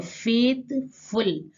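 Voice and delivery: "Faithful" slowly, broken into फेथ and फुल; two separate beats in "faithful"; split into syllables. Shown as a demonstration. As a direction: say फेथ, pause, then फुल. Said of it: "इस तरीके से दो syllable".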